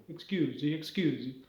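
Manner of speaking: demonstrating a stock Italian accent. Shown as a demonstration. Showing the way into an Italian accent, Italian accent. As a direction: say it in cartoon Italian. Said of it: "one word in each language that gets you into that language".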